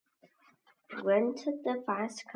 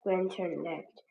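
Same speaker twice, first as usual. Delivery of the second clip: ordinary delivery, nothing marked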